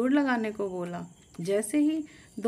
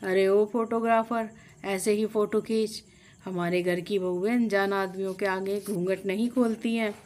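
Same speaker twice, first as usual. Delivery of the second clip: ordinary delivery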